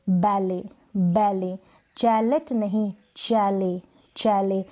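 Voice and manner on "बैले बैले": बैले ending on the vowel with no T; silent final T. Shown as a demonstration. Teacher repeating the correct बैले with silent T. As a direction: say बैले twice with the T dropped. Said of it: "बैलेट नहीं".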